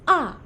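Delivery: "A" falling sharply, loud and high-started; fourth tone. Shown as a demonstration. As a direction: snap "A" down like an angry shout. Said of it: "seperti orang marah".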